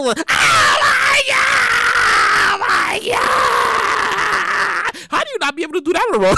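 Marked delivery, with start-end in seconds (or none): 0.2-5.0 coaster scream. Retold or imitated. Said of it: "The first thing you go".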